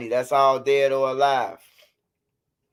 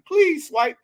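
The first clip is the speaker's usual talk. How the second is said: pleading fan; 13 semitones higher, 3 dB louder than usual. Retold or imitated. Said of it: "People inbox me".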